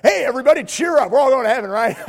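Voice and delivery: loud, high, hammed-up cheer; tactless cheerful shout. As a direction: boom cheer into a sickroom. Mock minister at deathbed.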